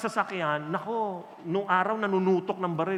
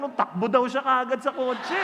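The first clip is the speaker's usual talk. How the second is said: ordinary talk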